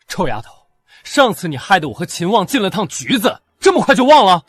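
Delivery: high voice rising to a shout; angry accusation. Acted. Captor blaming captive for police trouble, mounting angry outburst. Captor angrily recalls past grievance.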